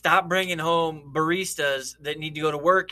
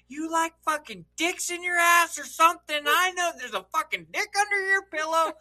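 mock screaming woman; 10 semitones higher than usual. Woman yelling at roommate, retold.

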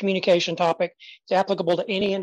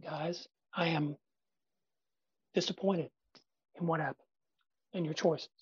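soft disappointed dad voice; 3 semitones lower, 11 dB quieter than usual. Shown as a demonstration. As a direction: speak low and quiet, letting the disappointment sit. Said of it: "When I talk like this".

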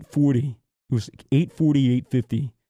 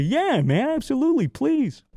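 much higher, bright eager yes; eager welcome; 11 semitones higher than usual. Retold or imitated. Friend on Discord eagerly offering a spare room, gushing yes. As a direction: gush out a delighted yes.

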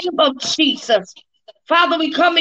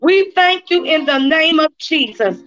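ordinary talk

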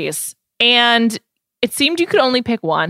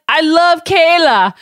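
slow, louder, higher attempt at Bear's accent; 8 semitones higher, 5 dB louder, much slower than usual. Host quoting Bear declaring love for Kayla, in a put-on Bear accent. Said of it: "I can't do a Bear's accent, though I try every week".

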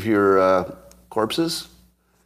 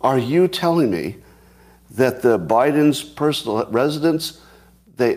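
ordinary talk, nothing marked

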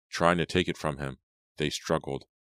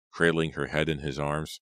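ordinary delivery

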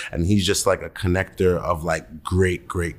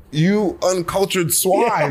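slow, high-pitched mock outrage; hammed-up disdain, drawn out; mock indignation; 10 semitones higher, slower than usual. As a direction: scold like an affronted connoisseur, laughing. Mock scolding of his mom.

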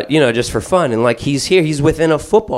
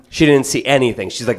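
normal talking voice

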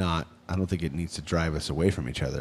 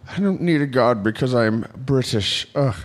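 condescending British; 4 semitones higher, 5 dB louder than usual. Acted. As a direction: look down on them in a put-on British accent. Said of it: "you're better at that voice. Can you say".

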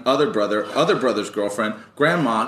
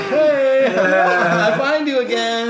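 high, loud, laughing hey; excited greeting; 11 semitones higher, 4 dB louder than usual. Retold or imitated. Greeting the girl again, high laughing delight.

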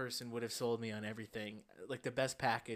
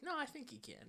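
soft, high-pitched coaxing; coaxing salesman; 12 semitones higher, 9 dB quieter than usual. Retold or imitated. Salesman nudging a hesitant customer, coaxing. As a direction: gently wheedle in a high put-on voice.

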